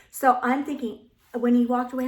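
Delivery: normal talking voice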